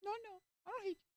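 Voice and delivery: very high, softened sing-out denial; passive-aggressive. Acted. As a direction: put on a high, falsely sweet voice. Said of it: "I'll do this passive-aggressive".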